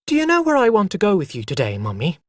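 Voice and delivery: quick, eager question to mother; child bursting with plans for the day; eager chatter. Acted. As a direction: rush it out with excitement.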